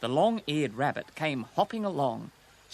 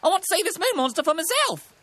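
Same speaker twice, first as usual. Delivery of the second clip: high, loud bird detective; high-pitched, determined magpie; magpie character; 7 dB louder than usual. A narrator's reading. Voices the magpie.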